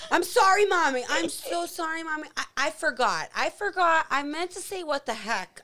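put-on high, loud little kid pleading apology; sorry little boy. Son apologizing to mom after cursing, high pleading kid voice. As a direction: beg forgiveness like a caught four-year-old. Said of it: "He goes".

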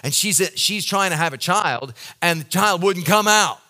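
full-force preaching. Acted. Pastor to congregation on the labor story, preaching at full force. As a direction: preach it hard and passionately.

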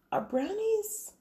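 put-on high, slow, wondering kid voice; imitated child. Daughter asking mom a random bedtime question, high child voice. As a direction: slowly puzzle it out like a small child.

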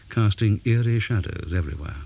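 ordinary delivery

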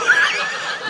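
breathy burst of laughter; real laugh. Real, not acted. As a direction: laugh at yourself. Laughing at misunderstanding.

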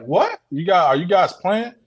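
high-pitched retold disbelief; incredulous reaction. Storyteller voices the group doubting the two men, incredulous. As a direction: squeak up on a doubting question.

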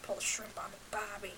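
Australian catchphrase in a whisper; whispered mock Australian. Joining in with Australian accent joke.

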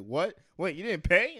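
loud, high alarmed question; alarmed staff. Acted. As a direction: call out in alarm. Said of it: "they're like".

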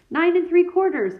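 put-on high piping little girl; piped. A narrator's reading. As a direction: pipe it up like a small girl. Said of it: "piped a small girl".

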